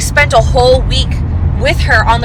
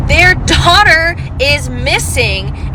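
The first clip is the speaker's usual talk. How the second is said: DAUGHTER and MISSING drawn out, high and indignant; outraged emphasis; 4 semitones higher, much slower than usual. Real, not acted. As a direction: hammer "Their daughter is missing" slowly, pitch raised. Outraged at the witness's self-pity.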